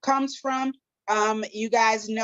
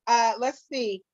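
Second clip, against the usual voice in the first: ordinary delivery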